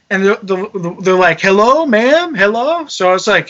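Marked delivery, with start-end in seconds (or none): 1.4-2.8 high, questioning phone hello; imitated agent checking who's there; calling down phone. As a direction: lift it up, calling for an answer.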